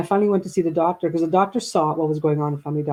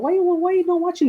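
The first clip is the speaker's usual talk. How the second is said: exasperated questioning; 13 semitones higher than usual. Retold or imitated. Daughter voicing her questioning.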